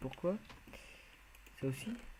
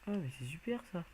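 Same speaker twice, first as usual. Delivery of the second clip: higher-pitched, sarcastic fake enthusiasm; mock delight; 5 semitones higher than usual. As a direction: pretend to be delighted, ironically. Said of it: "Non, je rigole". (faux enthousiasme ironique)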